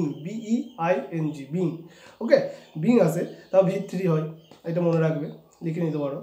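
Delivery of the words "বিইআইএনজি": বিইআইএনজি spelled letter by letter, slowly; slow letter-by-letter spelling of বিইআইএনজি. Shown as a demonstration. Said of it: "আইএনজি, তাহলে বিং".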